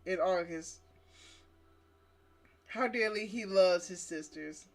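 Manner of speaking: ordinary delivery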